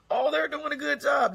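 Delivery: high, put-on bragging voice; hammed-up proud parent; mock proud parent. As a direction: play the smug parent praising the school. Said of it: "we walk around here like".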